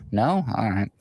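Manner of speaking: ordinary talk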